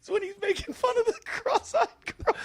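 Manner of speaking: high, squealing laughing talk; cracking up. Real, not acted. Retelling the comic's bad luck to friend, cracking up. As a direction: struggle to talk through helpless laughter.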